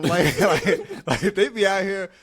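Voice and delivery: laughing through the words. Real, not acted. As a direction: laugh while telling it. Laughing about deer story.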